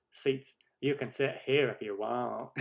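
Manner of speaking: slow Southern drawl; Forrest Gump. Acted. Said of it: "Forrest Gump accent".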